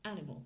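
"animal" in English, stressed hard on the first syllable; accent sur première syllabe. Shown as a demonstration. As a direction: punch AN in "animal", let the rest fade to schwas. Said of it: "l'accent tonique est sur ma première syllabe". (« animal » anglais, accent tonique au début)

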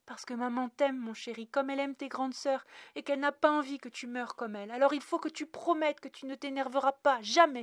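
high, loud, urgent declaration of love; impassioned motherly plea; pleading mother. A narrator's reading. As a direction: implore your child to promise, building in force. Mother pleads with her son.

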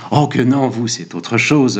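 usual speaking voice

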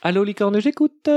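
singsong operator greeting climbing high. Acted. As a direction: sing out the hotline greeting, ending high. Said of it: "j'ai essayé de faire une voix".

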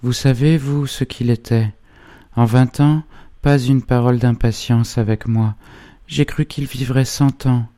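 put-on high-pitched, sorrowful young woman; grieving cry. A narrator's reading. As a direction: plead her loss in a high voice. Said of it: "cria-t-elle". (plainte aiguë et endeuillée)

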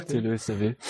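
soft low hypnotic murmur; hypnotist voice. Pretend. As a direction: lull the listener, quietly. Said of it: "mock hypnotist continues".

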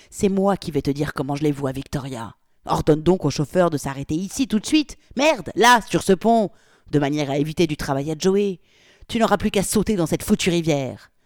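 loud, furious threats; furious tirade. Acted. Polly furiously orders Victoria to the bridge, loud rage.

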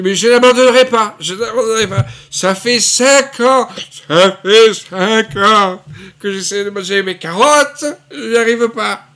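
plus aigu, fort et lent, exaspéré; imitation de Galabru. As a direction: jouer Galabru qui s'acharne sur ses carottes. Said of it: "Galabru dit". (voix de Galabru)

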